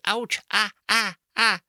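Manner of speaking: hurt whimpers, lightly faked; mock whimper of pain. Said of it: "Just cry when you're hurt and say".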